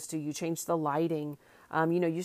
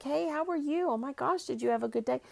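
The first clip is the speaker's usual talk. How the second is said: put-on much higher, bright baby-talk questions; cooing to child; 9 semitones higher than usual. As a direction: ask a toddler about his day, all sweetness. Greeting son after daycare.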